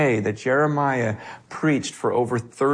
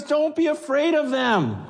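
urgent command; 13 semitones higher than usual. Retold or imitated. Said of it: "He goes".

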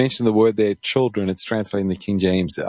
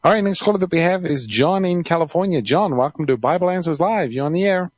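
higher-pitched, lilting on-air introduction; radio host; 8 semitones higher than usual. Real, not acted. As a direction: welcome the next caller, voice lifted. Host introduces next caller.

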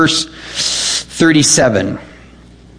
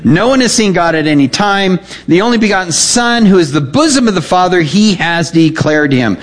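raised, declaiming scripture voice; scripture reading; 4 semitones higher than usual. Acted. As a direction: proclaim the verses from the pulpit. Reads scripture aloud.

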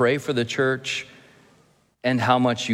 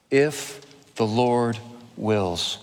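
slow, solemn "If the Lord wills"; slower than usual. Shown as a demonstration. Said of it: "repeats key phrase slowly".

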